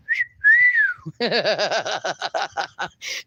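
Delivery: wolf whistle breaking into laughter. Pretend. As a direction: whistle teasingly, then crack up. Demonstrates a whistle, then laughs.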